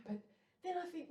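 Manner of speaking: usual speaking voice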